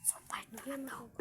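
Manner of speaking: hushed whisper so no one hears. Real, not acted. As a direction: whisper the intro while hiding. Repeats intro whispering while hiding.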